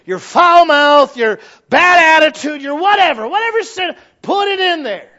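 loud, high, fervent preaching; preaching at full force. Acted. Preacher pounding the pulpit about practiced sin. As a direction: hammer it home to the congregation.

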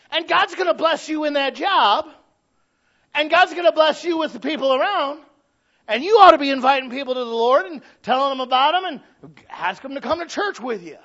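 loud, high, ringing preacher's blessing; full-force preaching. Real, not acted. Preacher promising the congregation God's blessing, full force. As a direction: proclaim it to the whole room.

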